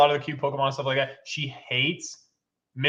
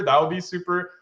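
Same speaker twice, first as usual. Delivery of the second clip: normal talking voice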